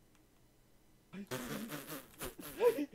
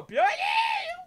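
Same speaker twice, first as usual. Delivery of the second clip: slow, high, laughing yell; laughing shout; 5 dB louder, much slower than usual. Real, not acted. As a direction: crack up mid-shout.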